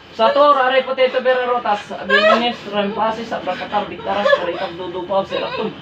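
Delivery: low, steady Latin-like incantation; low-pitched exorcist's invocation; ritual incantation. Real, not acted. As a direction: recite the summoning prayer low and unbroken.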